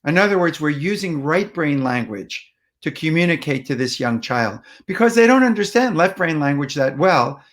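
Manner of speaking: ordinary talk, nothing marked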